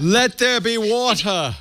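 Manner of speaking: high, slow, booming-style biblical decree; hammed-up godly pronouncement; mock divine proclamation. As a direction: proclaim it like a deity creating the world. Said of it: "Christ-like".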